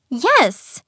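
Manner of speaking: loud, high cry; passionate outburst. A narrator's reading. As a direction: burst out with feeling. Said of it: "Evelyn cried in a passionate outburst".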